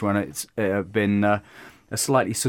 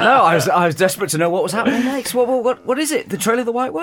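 high, gabbled eager questions; all excited; 10 semitones higher, 4 dB louder, faster than usual. Pretend. Guest desperate to know what happens next, all excited. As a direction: burst with desperate curiosity. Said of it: "You got all excited then".

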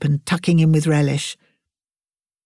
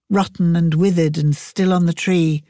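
usual speaking voice

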